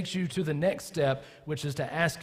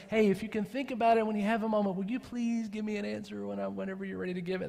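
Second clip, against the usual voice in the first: mock polite request; 7 semitones higher, faster than usual. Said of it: "That's not saying".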